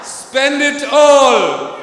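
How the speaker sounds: high, long-drawn shout; loud provocation. Shown as a demonstration. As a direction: stretch the taunt out loudly. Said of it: "Louder, meaner".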